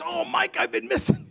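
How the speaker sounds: high, excited mock greeting from a former inmate. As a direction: squeal it like an old friend spotting you. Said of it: "he goes".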